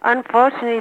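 ordinary delivery

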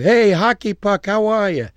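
loud, high, drawn-out street greeting; hearty call-out. Retold or imitated. Calling out to workers on street.

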